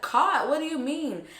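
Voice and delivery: high, startled disbelief; shocked question. Retold or imitated. Friend hearing her partner got caught, shocked. Friend reacts to the bad news.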